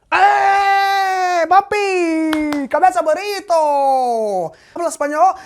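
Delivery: high, loud drawn-out street-vendor cry; booming high-pitched delighted greeting; exuberant shouting. Acted. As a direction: holler it in Spanish, stretching the vowels. Acted exuberant taco truck vendor.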